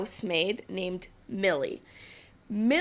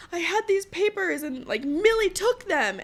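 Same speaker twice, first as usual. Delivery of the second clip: high whiny childish complaint, a touch hammed-up; 9 semitones higher, 3 dB louder than usual. Wife childishly blames the housemaid for missing papers, high whiny mock voice. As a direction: whine it like a sulking child blaming someone. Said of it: "kind of acting very childish".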